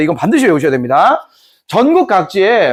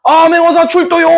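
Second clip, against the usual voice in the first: herald's cry; 10 semitones higher than usual. Acted. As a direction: call it out to the whole court. Royal inspector announced.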